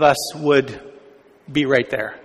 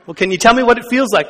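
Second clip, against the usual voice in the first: fast, higher put-on DJ voice; Robin Williams DJ; 6 semitones higher, 3 dB louder, much faster than usual. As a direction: pester him like a wacky radio host. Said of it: "Robin Williams is this D J".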